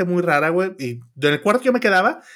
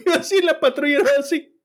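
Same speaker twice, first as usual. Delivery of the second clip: laughing; 10 semitones higher than usual. Real, not acted. Laughing at the ice cream memory.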